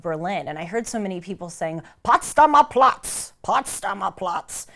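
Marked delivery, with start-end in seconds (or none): 2.0-4.7 slow, aggressive German accent impression; guttural German accent. Imitating the many people heard in Berlin, guttural German accent. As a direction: imitate a deep-voiced, forceful German accent, drawn out. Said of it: "like, low voice. It's a little bit aggressive".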